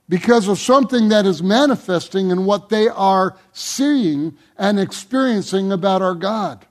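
ordinary talk, nothing marked

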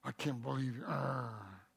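low, soft dog growl with a snarl. Acted. God pictured as a Rottweiler growling at you. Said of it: "a little growling".

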